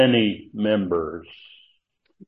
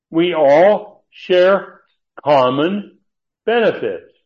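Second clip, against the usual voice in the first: each word drawn out, higher and louder; slow emphatic stress; 6 semitones higher, 6 dB louder, slower than usual. Real, not acted. Preacher to congregation on equal benefits, slow and emphatic. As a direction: lean hard on We all share common benefits.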